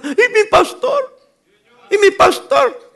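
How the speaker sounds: high, slow, whiny cry for the pastor; mock panicked yell. Preacher mocks a believer crying for his pastor at midnight. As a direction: ham up a helpless believer calling out. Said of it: "va a estar gritando".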